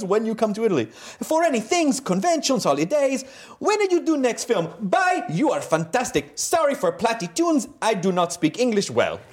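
high sing-song Italian broken English; Italian accent. Pretend. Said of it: "I'm gonna do another accent".

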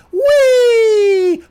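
long, loud high squeal; mock-gleeful shrieked whee; childish whee. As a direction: squeal it out like a kid on the loose. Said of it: "what you should have just typed".

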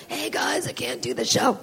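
voiceless, whispered announcement; mock struggling to speak with no voice; faked lost voice. Comic mocking an onstage apology to the crowd, voiceless whisper. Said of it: "not getting on stage and going".

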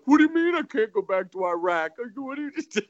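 high-pitched blubbering protest, hammed up; mock crying. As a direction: imitate a grown man wailing. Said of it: "and he's just crying".

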